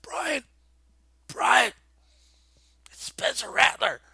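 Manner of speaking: high, excited calling to a friend; urgent, high-pitched retold alert. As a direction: re-enact trying to get a buddy's attention.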